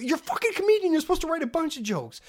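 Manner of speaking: very high-pitched indignant scolding; exasperated rant. Pretend. Viewer telling off a lazy comedian, exasperated.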